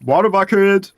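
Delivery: loud urgent yell, pitch up; excited shout. Real, not acted. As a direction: shout it like a rescue call. Urgent call in risky build.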